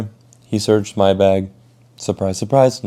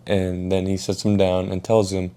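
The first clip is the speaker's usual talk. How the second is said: normal talking voice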